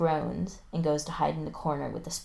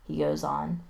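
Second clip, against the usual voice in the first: usual speaking voice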